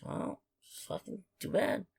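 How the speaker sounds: ordinary talk, nothing marked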